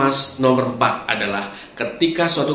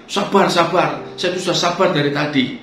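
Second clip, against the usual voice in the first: an angry customer throwing it back; irritated customer; 5 semitones higher than usual. Retold or imitated. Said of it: "Dia akan bisa bilang gini". (pelanggan kesal menirukan dengan nada tinggi)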